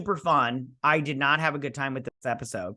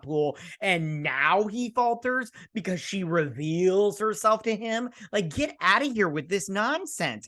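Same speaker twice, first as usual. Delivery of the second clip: incredulous rant; 6 semitones higher than usual. Real, not acted. Reviewer ranting to viewers about a forced plot turn, incredulous. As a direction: stretch the key words in high disbelief.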